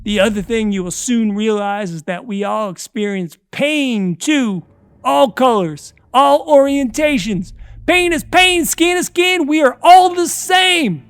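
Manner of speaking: from steady to high loud frenzy; building rant. Acted. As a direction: build to a shrieking peak. Captor's building manic sermon.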